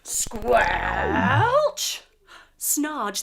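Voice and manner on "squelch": breathy squelch rising; whispered squelch. Acted. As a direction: squish through the mud. Second mud step.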